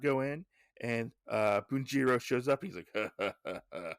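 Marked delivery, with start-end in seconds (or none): 2.9-3.9 low, measured mock villain laugh; gloating stage laugh, each ha separate; put-on evil chuckle; villain's chuckle.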